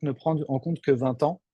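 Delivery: normal talking voice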